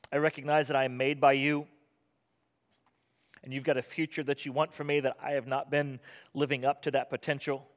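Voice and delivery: earnest spoken prayer. Acted. Leads a closing prayer.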